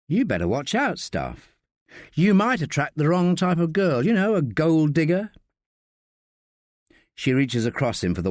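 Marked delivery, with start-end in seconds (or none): 0.1-5.3 lightly voiced teasing woman; mock warning in a higher character voice; Josie, teasing. Josie warning Staff about gold diggers, teasing.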